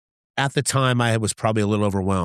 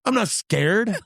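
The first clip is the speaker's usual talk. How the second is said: high, strained mock protest; indignant denial; 10 semitones higher, much slower than usual. Driver answering his passenger's question, indignant. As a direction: deny it hotly, pitched way up.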